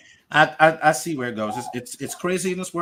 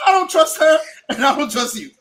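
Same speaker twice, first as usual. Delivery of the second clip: high, loud mock protest; emphatic distrust; 11 semitones higher, 7 dB louder than usual. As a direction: protest loudly, half laughing, in a raised pitch. Acted refusal to trust either friend.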